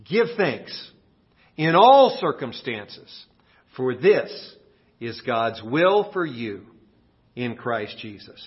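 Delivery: slow, measured verse; slow scripture recital. Shown as a demonstration. Pastor reciting the verse to memorize, slow. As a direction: lay each phrase down slowly.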